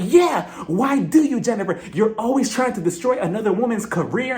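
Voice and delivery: upset Margaret. Retold or imitated. Margaret attacking Jennifer at the reunion, upset high outburst. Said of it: "She was so upset".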